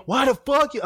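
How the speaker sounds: high mock angry yell; angry superior. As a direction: demand the keys in a high shout.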